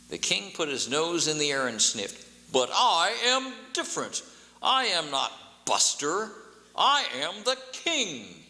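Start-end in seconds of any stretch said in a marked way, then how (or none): 2.5-8.5 slow, high, lofty royal declaration; kingly voice. A narrator's reading. King insists to the shopkeeper he is royalty, slow and lofty. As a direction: draw yourself up and proclaim it. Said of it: "he said in a very kingly voice".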